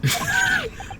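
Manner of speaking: high unvoiced yelp of panic; a squeaky hammed-up gasp; mock pleading cry. Accused suspect yelping at the threatening detective, mock panic cry.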